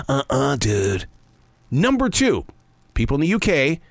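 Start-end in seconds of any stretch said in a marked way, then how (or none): 0.1-1.1 low, slow-witted surfer dude; Spicoli. Acted. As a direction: mimic a California surfer stoner, pitched down. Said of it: "Not the surfer dude Spicoli".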